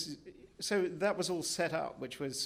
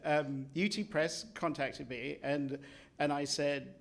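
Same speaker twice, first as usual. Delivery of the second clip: ordinary talk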